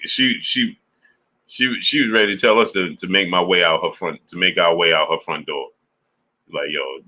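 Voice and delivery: normal talking voice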